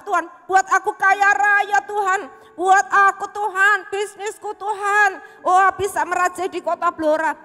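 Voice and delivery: loud, high, hammed-up selfish prayer; mock the greedy pray-er loudly; preacher mocking a greedy prayer, loud. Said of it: "adakah kita berdoa".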